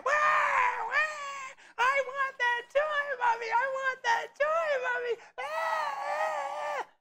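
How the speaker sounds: put-on very high, loud bawling child voice; crying kid. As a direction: wail like a screaming kid throwing a toy tantrum. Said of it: "And they're crying".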